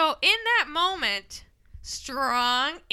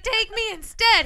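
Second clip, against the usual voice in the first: mock pleading; 8 semitones higher, 3 dB louder than usual. Voices mother offering herself.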